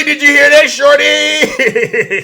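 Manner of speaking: loud, high gloating call to Shorty; gleeful taunt. Real, not acted. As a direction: crow it at him, rubbing it in. Taunting a commenter after clip.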